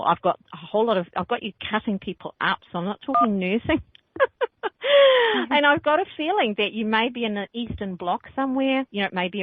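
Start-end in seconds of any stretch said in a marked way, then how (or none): 4.1-5.4 a sudden high laugh; high burst of laughter. Real, not acted. Psychic laughs at her own surgeon joke, high and loud.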